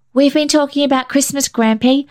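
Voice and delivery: put-on high, eager child voice; a small boy chattering to grandpa; bright questioning child; little boy Billy.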